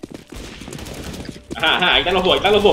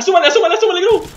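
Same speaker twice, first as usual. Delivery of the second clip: laughing; 10 semitones higher, 5 dB louder, faster than usual. Real, not acted. Laughs while urging teammate.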